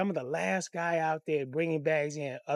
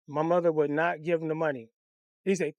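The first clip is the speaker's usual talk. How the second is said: normal talking voice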